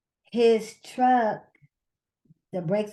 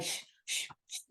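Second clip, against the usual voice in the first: soft unvoiced hiss of failing brakes; imitated hissing brakes; 14 dB quieter than usual. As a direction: hiss it quietly like air leaking from a brake hose. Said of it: "I could hear this noise, like".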